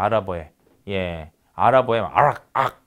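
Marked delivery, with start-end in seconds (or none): 2.0-2.8 "아락, 악" caught at the back of the throat; uvular demonstration. Shown as a demonstration. Lecturer imitates Arabic sounds, "아락, 악" made at the uvula. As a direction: end "아락" and "악" on a uvular stop. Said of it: "이런 소리들 다 국외음 소리예요".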